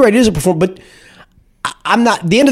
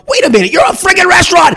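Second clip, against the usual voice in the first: hypothetical yell; 14 semitones higher than usual. Pretend. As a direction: yell it at the drive-thru worker, hammed up. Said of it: "could I have yelled and gone".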